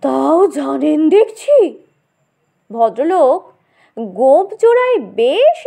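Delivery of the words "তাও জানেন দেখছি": astonished. Acted. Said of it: "চোখ কপালে তুলে বললে".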